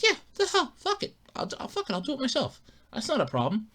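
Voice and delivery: high, then soft and breathy; high resigned shrug. Retold or imitated. Owner decides to fix the car himself, high and soft.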